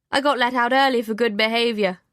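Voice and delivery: light, joking tone. A narrator's reading. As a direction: tease it playfully. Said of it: "he jokes".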